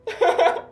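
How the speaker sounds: high, pleased little giggle; smug giggle. Acted. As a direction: laugh it off, flattered. [name] laughs at praise.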